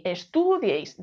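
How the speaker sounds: "estudiéis" mis-stressed as es-TU-dieis; "estudiéis" with the accent thrown onto a long TU; wrong middle stress. Shown as a demonstration. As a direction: stretch and hit the "tu" in "estudiéis". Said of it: "El golpe de voz está aquí. No decimos".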